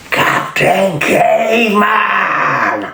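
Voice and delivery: high, long drawn-out cartoon battle cry; Captain Caveman. Recalling a cartoon hero's catchphrase, high hollered cry. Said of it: "I was Captain fucking Caveman".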